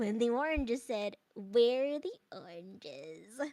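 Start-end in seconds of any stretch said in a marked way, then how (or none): 1.3-3.4 low, slow drawn-out puppet line; slow talking-orange character. Acted. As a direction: copy the puppet voice, stretching every word. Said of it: "the oranges gotta sound like this".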